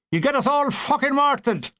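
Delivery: high angry Irish complaint. Acted. Irish soldier scolding the noisy linemen, Irish accent.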